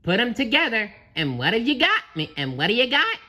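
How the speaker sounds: high, loud, eager question; SpongeBob hopeful. Acted. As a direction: lilt upward, hoping for success. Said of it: "SpongeBob asked hopefully".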